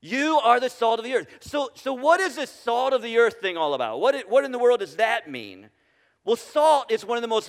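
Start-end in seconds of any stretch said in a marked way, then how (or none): none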